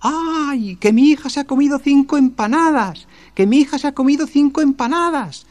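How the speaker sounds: high, loud frantic shouting; screaming. Acted. Mother screaming that her daughter ate five empanadas. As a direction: wail it out to the whole street. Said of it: "Y se puso a gritar".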